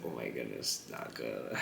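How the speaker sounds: low, soft aside; hushed dismay. Retold or imitated. Retelling own reaction to an offended streamer, hushed dismay. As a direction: drop right down, as if awkwardly backing off.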